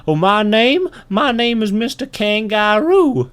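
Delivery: high, loud animal voice; Mister Kangaroo. Acted. Kangaroo telling the ant his name, high bouncy voice. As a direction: announce it as a bouncy cartoon kangaroo. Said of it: "And he says".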